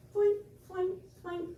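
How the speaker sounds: high, playful flink sounds; imitated flicking noise. Teacher flicking off the last rods, high mock sound effect. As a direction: mimic rods popping off, high and light.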